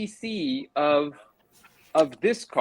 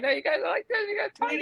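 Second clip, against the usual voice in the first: squeaky tiny voice; faster than usual. Pretend. Tiny video view, squeaky voice.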